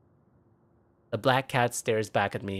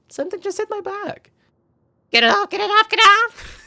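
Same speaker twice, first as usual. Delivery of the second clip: much higher, frantic, loud panic; panicked shriek; 10 dB louder than usual. Acted. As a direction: shriek to get it off. Panics as something hits her.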